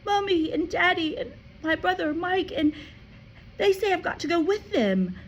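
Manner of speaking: put-on high, pleading child's voice; a child's distress; upset girl. A narrator's reading. Matilda explains to Miss Honey, upset.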